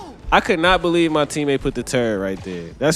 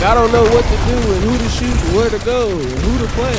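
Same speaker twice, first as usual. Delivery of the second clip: higher, rushed mock of a lost teammate; imitating clueless teammate; 6 semitones higher, faster than usual. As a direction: rattle it off like a confused player, pitched up. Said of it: "he was just like".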